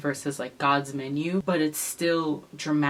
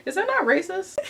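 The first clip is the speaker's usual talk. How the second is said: incredulous; 3 dB louder than usual. Real, not acted. Indignant rhetorical question.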